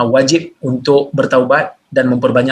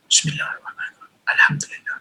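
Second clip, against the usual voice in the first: hushed whisper, just audible to oneself; whispered recitation; 8 dB quieter than usual. Shown as a demonstration. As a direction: recite under your breath, barely reaching your own ears. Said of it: "Mesti dengar pada telinga sendiri". (bisikan perlahan, cukup didengar telinga sendiri)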